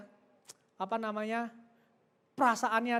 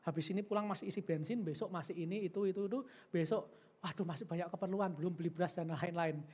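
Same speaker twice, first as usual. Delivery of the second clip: quick, softer fretful muttering to oneself; worried inner voice; 5 semitones lower, 8 dB quieter than usual. Acted. The mind fretting over money before giving, softer worried mutter. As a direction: rattle off worries under your breath. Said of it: "Tapi pikiran kita ngomong apa?".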